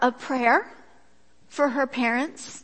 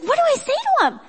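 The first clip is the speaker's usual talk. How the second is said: high, anxious mock panic; panicky friend; 14 semitones higher, 5 dB louder, faster than usual. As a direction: squeak it out in a fluster.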